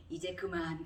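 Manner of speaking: lightly voiced, restrained divine reply; voice of God. God answering the grown believer, lightly voiced quiet authority. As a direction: gently cut off the complaint, understated. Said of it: "어느 정도 성숙하니까 하나님이".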